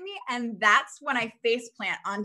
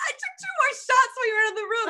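higher, fast, sheepish admission; sheepish confession; 12 semitones higher, 6 dB louder, faster than usual. Retold or imitated. [name] owning up to her friend, fast and higher. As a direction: rush the confession out like a guilty kid. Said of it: "I was like".

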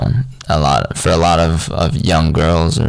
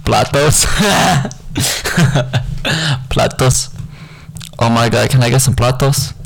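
mock Spanish accent; 6 semitones higher than usual. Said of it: "random phrases and words that I've learned".

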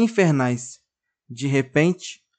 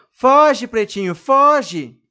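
higher, louder urgent yell, slow on the second foge; shout; 9 semitones higher, 7 dB louder, much slower than usual. A narrator's reading. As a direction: scream a warning to a friend.

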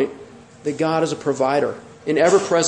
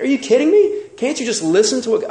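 exasperated Moses; 6 semitones higher than usual. Retold or imitated.

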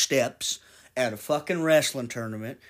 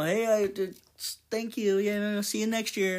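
smug joker; 9 semitones higher than usual. Pretend. Acting the joker answering back.

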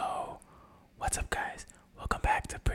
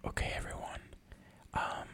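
hushed whisper so neighbors sleep; breathy late-night whisper; 12 dB quieter than usual. Shown as a demonstration. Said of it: "this episode to be fully whispering".

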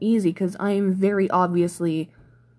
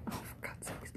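exasperated whisper; 24 dB quieter than usual. Real, not acted. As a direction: breathe it out in frustration. Muttering at mistake in game.

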